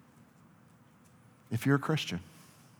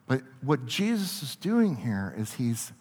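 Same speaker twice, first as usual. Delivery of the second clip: ordinary talk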